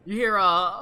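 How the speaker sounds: ordinary talk, nothing marked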